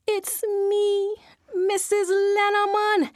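high, timid reply; nervous answer. Acted. Wife answering the invisible voice nervously. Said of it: "wife answers the voice".

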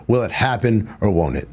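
usual speaking voice

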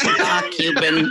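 lightly voiced, slow drawn-out Mark Cuban. Host asks who voices Cuban, slow drawn Cuban voice. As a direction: stretch the name out slowly as the cartoon Cuban. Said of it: "Which one of you is Mark Cuban?".

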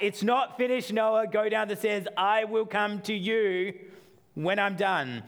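mock-stern dad sending the kid away; exasperated dad. Dad telling his son to go downstairs, exasperated. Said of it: "he is back up the stairs".

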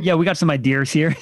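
put-on New York accent, "ideas" as eye-DEERS; Joe. Imitating a friend's speech, New York accent, "ideas" as eye-DEERS. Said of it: "throw a little R on".

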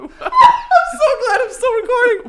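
high, loud laughing squeal; helpless real laughter while talking; cracking up. Real, not acted. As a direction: wheeze it out slowly through laughter. Cracking up at odd sign-off.

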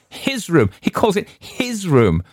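whole party shouting in derision; mocking shout. A narrator's reading. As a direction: roar it out in ridicule. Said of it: "shouted the whole party".

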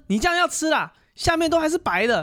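high, loud put-on auntie voice; mock angry shop owner telling off a customer; imitating the scolding owner. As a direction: scold the customer who didn't stir. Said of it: "她说".

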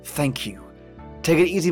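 ordinary talk, nothing marked

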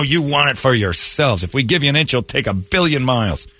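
ordinary talk, nothing marked

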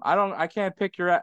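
ordinary talk

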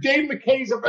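ordinary delivery

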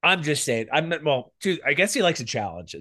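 ordinary talk

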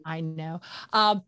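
ordinary delivery

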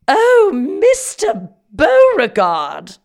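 hammed-up Southern swoon, high and loud; Southern accent. Mock swooning at a suitor, high, loud Southern drawl. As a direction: stretch it out like a melodramatic Southern belle. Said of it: "It's more like that".

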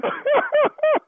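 real high burst of laughter; high-pitched laugh. Real, not acted. Host laughing at victim ready to fight, high squeal.

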